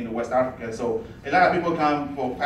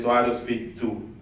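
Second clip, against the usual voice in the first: normal talking voice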